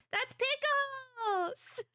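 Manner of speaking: high, drawn-out gleeful squeal; light up at the name; delighted squeal. Real, not acted. Streamer squealing as villains mention her dog, high and slow.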